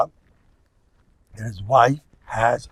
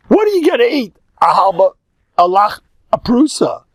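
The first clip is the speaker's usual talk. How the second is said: mock scorn; 7 semitones higher, 6 dB louder than usual. Mocking the meager food.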